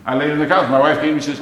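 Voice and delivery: ordinary delivery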